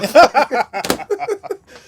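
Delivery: big burst of high laughter; loud laugh. Real, not acted. Big laugh at own story.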